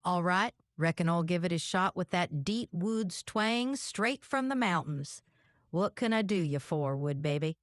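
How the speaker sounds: deep-woods Virginia mountain twang; hillbilly twang. Pretend. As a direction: play up the Southern hillbilly accent. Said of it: "like deep woods of Virginia".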